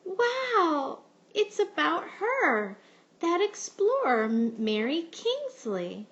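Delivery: high, loud delighted wow; excited sounding out. A narrator's reading. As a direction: gasp with joy, then sound out the name. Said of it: "sounded out the words".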